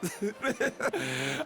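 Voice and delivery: high gleeful cackle; gleeful laugh. Acted. Host gloating over the tricked, high cackle. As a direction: cackle at their misfortune. [name] mocking his guests.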